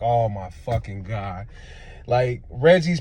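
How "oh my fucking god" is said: stunned disbelief. Retold or imitated. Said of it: "I'm like".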